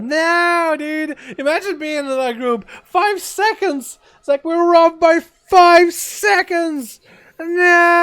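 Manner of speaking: high, loud cry of disbelief, drawn out; anguished disbelief. Real, not acted. Streamer wails over a group missing the timer, high and loud. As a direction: wail that they were robbed by seconds.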